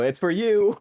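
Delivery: sibling taunting with the phone; smug handoff. Acted. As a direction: gloat as you toss it over. Tossing phone to brother.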